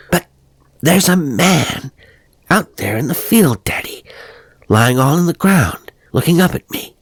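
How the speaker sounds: put-on boy telling his father about the man; excited boy. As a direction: blurt out the secret like a small boy. Boy tells father urgently.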